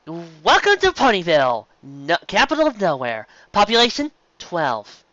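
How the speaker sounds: mock the town like a cheesy welcome sign; sneering parody of a town greeting. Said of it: "Cannon continued in a mocking voice".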